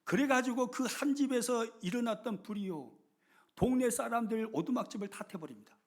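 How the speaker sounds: ordinary talk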